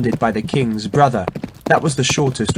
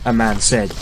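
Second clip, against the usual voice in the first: usual speaking voice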